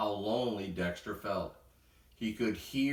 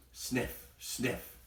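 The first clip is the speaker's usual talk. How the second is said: two whispered sniffs; sniffing; 2 semitones higher, 6 dB quieter than usual. A narrator's reading. Animals sniffing the popcorn smell in a whisper. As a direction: sniff the air softly.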